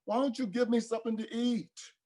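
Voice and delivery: put-on high pleading little child's voice; crying child. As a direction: beg like a hungry small kid.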